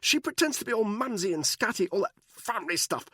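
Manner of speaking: high, stammering rush; babbling. A narrator's reading. Buckingham babbling blame under Danny's grip. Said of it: "He started babbling".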